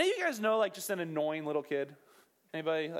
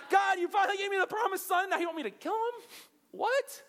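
much higher, loud disbelieving protest; outraged shout; 12 semitones higher, 3 dB louder than usual. Retold or imitated. Abraham protesting God's command, outraged shout. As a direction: cry out at God in disbelief.

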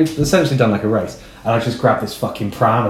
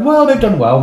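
pitched way up, louder retort; high-pitched mock reply; 12 semitones higher, 5 dB louder than usual. Podcaster retelling his reply to the swearing fan, high mock protest. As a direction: jump high, as a retold objection.

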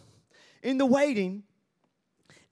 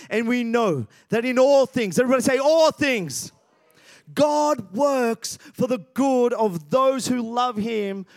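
louder, higher, rising preacher's cry; preaching at full force; 5 semitones higher, 5 dB louder than usual. Acted. Pastor rousing the congregation to believe, full-force preaching. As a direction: build to a roaring declaration of hope.

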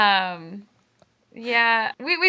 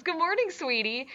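mock sweet greeting; 5 semitones higher, 7 dB quieter than usual. Mocking Mako's line again.

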